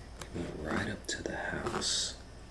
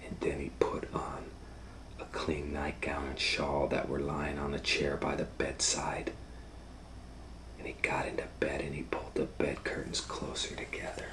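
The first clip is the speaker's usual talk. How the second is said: hushed, breathy-free whisper telling the tale; whispered story narration; 6 dB quieter, faster than usual. A narrator's reading. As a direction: whisper it like a bedtime secret. Whispered storytelling of wolf scene.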